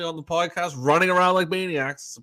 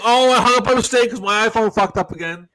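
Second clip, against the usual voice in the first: higher, louder mocking imitation; hammed-up excuse; mock Andrew excuse; 8 semitones higher, 8 dB louder than usual. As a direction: imitate a friend's lame excuse. Quoting Andrew's excuse on phone.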